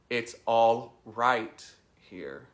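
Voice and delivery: slow mantra with a strong "right"; "right" stressed and drawn out. Shown as a demonstration. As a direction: lean hard on "right", then pause before the last word. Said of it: "emphasize a different word".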